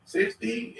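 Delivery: normal talking voice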